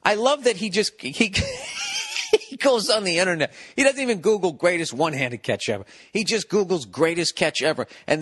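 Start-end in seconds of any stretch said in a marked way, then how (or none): 1.4-2.3 real laugh cracking high; laughing at his buddy's logic; high shouted laugh. Real, not acted. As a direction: burst out in a shrieking laugh.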